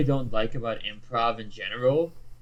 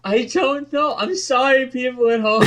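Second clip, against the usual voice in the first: very high comic wail; mock-desperate apology; 13 semitones higher, 4 dB louder than usual. Saying sorry to people at home, mock-desperate apology. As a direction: plead forgiveness in a squeaky, hammed-up panic.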